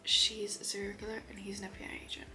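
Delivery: soft secretive whisper. Real, not acted. Summarizing the series plot for viewers in a whisper. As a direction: whisper the twist.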